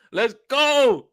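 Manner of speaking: high, loud hype call; pumped-up rallying cry; hyped. Real, not acted. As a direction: go right up into a buzzing cheer. Repeats hype call, much higher.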